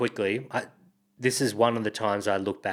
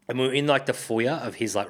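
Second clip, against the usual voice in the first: ordinary talk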